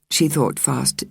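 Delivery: ordinary delivery, nothing marked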